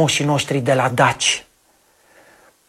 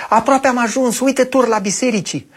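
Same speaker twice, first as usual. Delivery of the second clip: put-on child voice; 8 semitones higher than usual. Copil vorbește în poveste.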